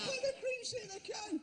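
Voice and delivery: high-pitched mimicry of her guess; mocking echo. Presenter repeating the woman's odd guess, high mocking echo. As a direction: echo it back high, in disbelief.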